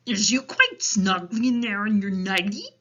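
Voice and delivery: loud storybook giant voice; concerned, rustic-sounding giant; Big Friendly Giant. A narrator's reading. Giant speaking to Sophie.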